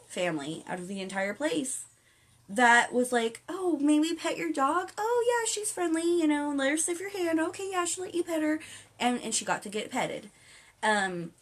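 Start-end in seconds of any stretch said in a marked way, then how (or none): 3.5-8.6 high, sugary polite exchange; sweet polite voice. Retold or imitated. A friendly family asking to pet the dog, sweet and high.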